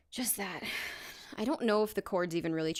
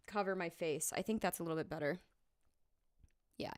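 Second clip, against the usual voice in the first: usual speaking voice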